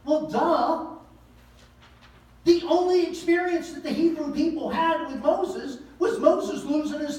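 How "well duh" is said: usual speaking voice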